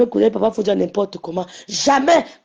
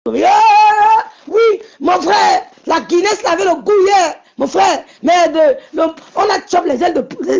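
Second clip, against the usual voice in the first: loud, high-pitched whooping lads' banter; rowdy guys; 7 semitones higher, 7 dB louder than usual. Retold or imitated. Guys hailing each other about last night's Guinness, rowdy shouting. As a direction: shout it like excited mates recapping last night. Acting out the guys' rowdy chat.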